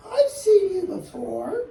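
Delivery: high, bright bird voice. Acted. Lazy bird Maisie to Horton, high voice. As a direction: sing it up high like a silly bird.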